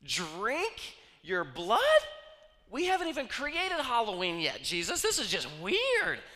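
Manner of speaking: high, loud, slow outraged squeal; mock disgusted listener. Fan recoiling from Jesus's words in high loud disgust.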